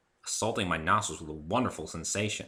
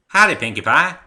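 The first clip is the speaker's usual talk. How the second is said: friendly southern farm mare greeting; Applejack's voice; 9 semitones higher, 15 dB louder than usual. Acted.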